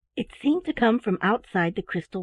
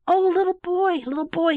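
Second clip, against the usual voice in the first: put-on excited boy's cry, higher and louder; cried out; 9 semitones higher, 4 dB louder than usual. A narrator's reading. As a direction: call out with delight. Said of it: "he cried".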